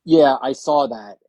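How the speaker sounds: ordinary delivery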